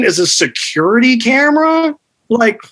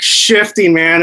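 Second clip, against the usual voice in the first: ordinary talk, nothing marked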